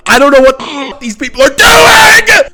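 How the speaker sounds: high, loud, slowed shout of disbelief; real exasperated yelling. Real, not acted. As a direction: yell it at the ceiling, fed up. Frustrated rant about movie.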